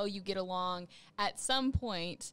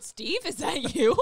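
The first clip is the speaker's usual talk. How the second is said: slow, loud, laughing, higher; laughing mock accusation; 6 semitones higher, 10 dB louder, slower than usual. Laughing as she teases her cohost about his heart. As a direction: tease him through a laugh. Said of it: "I said".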